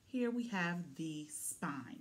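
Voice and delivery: usual speaking voice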